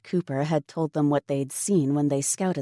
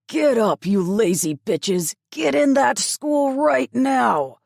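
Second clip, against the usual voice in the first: much louder, higher, slow furious bellow; angry bellow; 9 semitones higher, 7 dB louder, slower than usual. A narrator's reading. Guard yelling at captives to get inside, furious bellow. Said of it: "He bellowed angrily at them".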